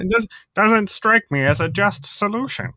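mock him with a nasal lecturing tone; Jordan Peterson impression. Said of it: "mock someone's voice".

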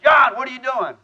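mock-bark his name loud and high; retold shout. General calls hungover colonel early Monday, loud mock bark. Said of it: "And he said".

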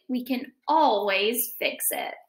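ordinary delivery, nothing marked

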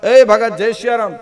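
high, loud call to the house; sadhu calling out. Acted. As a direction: call out loudly to the doorway. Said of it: "સાધુએ રાડ પાડી કે". (ઊંચા અવાજે હાક)

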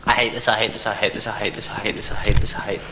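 hushed panicked muttering; frightened whisper. Real, not acted. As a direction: repeat it under your breath, scared. Hiding in fear.

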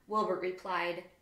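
ordinary delivery

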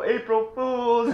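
high, slow drawn-out call, not as high as the first. Retold or imitated. Cree calling out again, high and drawn out but a step down. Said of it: "I'll yell out the window".

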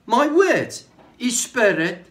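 high, loud, impassioned preaching; preaching at full force. Acted. Preacher exhorting the congregation at full force. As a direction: lift the voice and drive each phrase home.

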